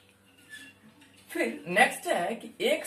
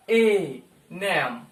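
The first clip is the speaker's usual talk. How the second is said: normal talking voice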